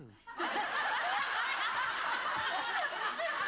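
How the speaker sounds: very high burst of laughter; high shrieking laugh. Real, not acted. Actress laughing at her own B and B story, high shriek. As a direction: squeal with laughter at yourself.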